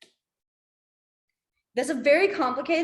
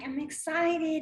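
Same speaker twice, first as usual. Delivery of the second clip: higher, drawn-out mock excitement; demonstrated excitement; 4 semitones higher, 3 dB quieter, slower than usual. Shown as a demonstration. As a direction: stretch out the key word with a lift in pitch. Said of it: "a lot of times you're like".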